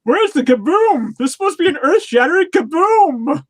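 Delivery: high, swooping cartoon Martian voice; Marvin the Martian. Host quoting favorite Martian line, high cartoon impression. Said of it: "my favorite M-, Marvin the Martian line of all time".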